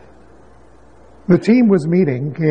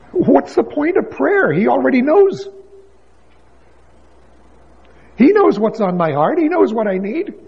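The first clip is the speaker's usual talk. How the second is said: high, questioning, incredulous; raised doubting questions; 6 semitones higher than usual. Acted. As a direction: voice the doubter's challenge.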